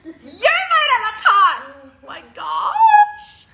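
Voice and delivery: high, loud gush, half laughing half crying; teary delighted squeal. Real, not acted. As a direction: gush to the hairdresser through happy tears. Gushing thanks to hairdresser, laughing.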